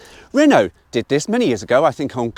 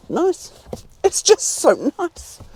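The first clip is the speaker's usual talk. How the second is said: high delighted coo; 13 semitones higher, much slower than usual. Real, not acted. Reviewer delighted by the flat rear floor, cooing high.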